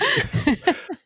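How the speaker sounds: a high laugh through tears; high teary laugh. Real, not acted. Answering her friend's tears with a high, teary laugh.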